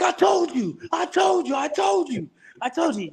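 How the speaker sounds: high gloating shout that fades off; triumphant yelling. Retold or imitated. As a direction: rub it in, shouting. Gloating to coach after interception.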